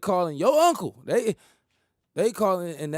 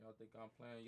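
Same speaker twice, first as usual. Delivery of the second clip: ordinary delivery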